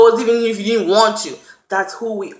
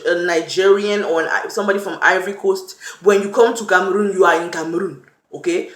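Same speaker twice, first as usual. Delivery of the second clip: ordinary delivery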